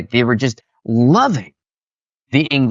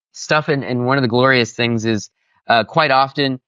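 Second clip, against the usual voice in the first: ordinary talk, nothing marked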